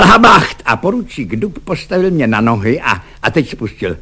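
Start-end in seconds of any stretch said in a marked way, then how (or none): 0.0-0.6 loud, high-pitched military yell; roared command. A narrator's reading. Cadet jumps on a dozing soldier, roaring. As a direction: roar it like a drill order. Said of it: "zařval".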